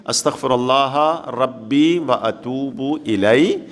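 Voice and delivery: very slow, measured prayer formula; slow recitation. Shown as a demonstration. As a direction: stretch each word for learners to follow. Teacher repeats formula for learners. (ٹھہر ٹھہر کر تلاوت)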